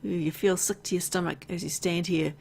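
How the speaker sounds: ordinary talk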